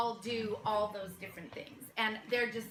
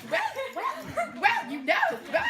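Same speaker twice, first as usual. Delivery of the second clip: prim, very high-pitched little voice; fussy high-voiced prim lady; prissy Missus Soames; 4 dB louder, faster than usual. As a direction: pipe it up high and prissy, each word set apart. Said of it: "She was our prissy Missus Soames".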